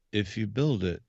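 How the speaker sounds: lightly voiced Costner, low and hushed; Kevin Costner impression. Host quotes Field of Dreams for his cohost. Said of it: "give me something from Field of Dreams".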